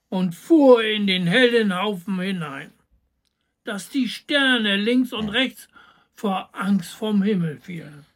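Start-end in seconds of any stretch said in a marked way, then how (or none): none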